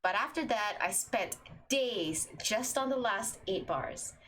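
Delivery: usual speaking voice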